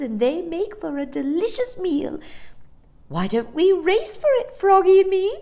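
high, loud eager character voice; Amelia the greedy dolphin. Acted. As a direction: propose a race with relish.

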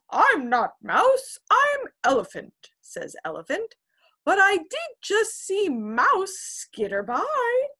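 high, loud silly elephant. Acted. Elephant answers the kitten, high and flustered.

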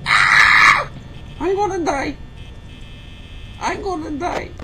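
building from a mock lament to high shrieking; hammed-up shout. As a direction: rant in mock despair until screaming. Escalating theatrical rant.

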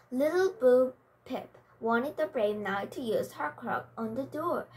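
plain reading voice

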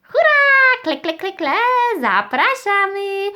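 very high, excited stork squeal; cheering stork puppet. Pretend. Stork invites children to play, high cheering voice.

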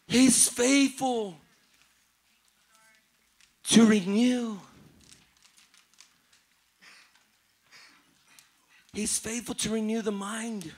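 gentle preacher over music. Real, not acted. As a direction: ease the room into worship. Soft ministry over worship music.

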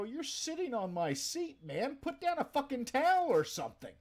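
high, loud disgusted outburst; outraged yell. Retold or imitated. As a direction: yell at him for ruining the seat. Coworker's burst boil on seat.

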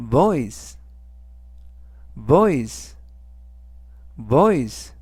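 voice, modelled carefully; model pronunciation. Shown as a demonstration. Teacher modelling voice for students, clear. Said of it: "라고 발음하시면 되겠고요".

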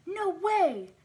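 high, loud yell of disbelief. A narrator's reading. Harper hearing that home has burned down, yelling. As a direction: yell it out, pitch leaping right up. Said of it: "Harper yelled".

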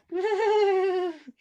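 high, put-on laugh; Mary Poppins. Vlogger imitating a film nanny's laugh, high pitched. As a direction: let out a high character laugh. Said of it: "Mary Poppins doing the laugh".

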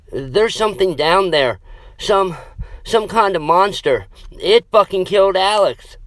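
high, panicked stammer with a stumble. Acted. Scott tells the girls about the monster, stammering in fear. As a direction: stumble over the words in terror. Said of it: "Scott stammered".

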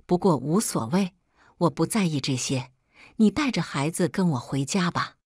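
plain reading voice

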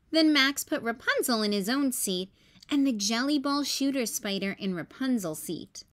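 plain reading voice

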